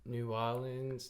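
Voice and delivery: slow "New Orleans" softened to "new AW-linz"; half-slurred pronunciation. Shown as a demonstration. Showing the name loosening, "New Orleans" as "new AW-linz". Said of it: "It just flows".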